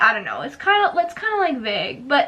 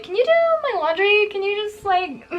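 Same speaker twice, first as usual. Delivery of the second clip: high mock whine, pleading; whiny girl story; 8 semitones higher than usual. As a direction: pitch it up like a put-upon girl asking for help. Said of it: "they're just like".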